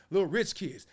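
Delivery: ordinary delivery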